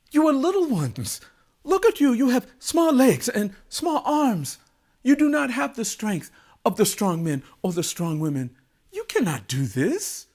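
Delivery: high, loud protest, easing down; incredulous king. A narrator's reading. The king doubts the little children can help, incredulous. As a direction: exclaim in disbelief as the king.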